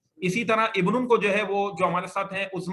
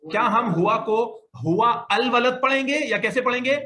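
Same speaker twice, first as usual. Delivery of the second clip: quizzing question; 5 semitones higher, 5 dB louder than usual. Real, not acted. Teacher quizzing class on reading.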